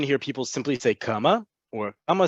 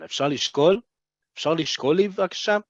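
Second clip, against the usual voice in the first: אפשר לשקול asked with a rising end; questioning tune; 4 semitones higher, much faster than usual. Shown as a demonstration. Teacher shows learners the phrase asked as a question, rising questioning tune. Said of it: "Asked as a question".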